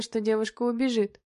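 plain reading voice

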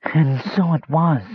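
high, thin, slow squeak; squeaking rat. A narrator's reading. Mage turned rat confirming his tale, squeaking. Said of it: "squeaked a red-eyed rat".

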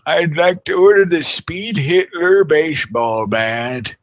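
slow, high put-on prank caller; hammed-up phone prank voice. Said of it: "it'd be funny to call in".

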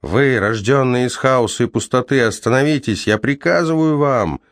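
high ringing commanding call. Acted. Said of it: "звенящим голосом закричал он вновь".

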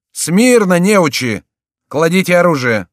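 high, loud, scolding order; shouted command. A narrator's reading. Duke to his clumsy militia, shouting angrily. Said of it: "закричал герцог".

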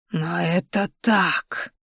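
slow, soft crone's reply; very old faint voice. Acted. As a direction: answer calmly as an old witch. Old healer answers. (тихий голос старухи)

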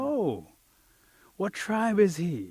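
slow, drawn-out high question; retold awe. Woman asking about the huge man, retold awe.

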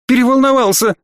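high-pitched, agitated excuse; flustered exclamation. A narrator's reading. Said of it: "воскликнул Бржиза".

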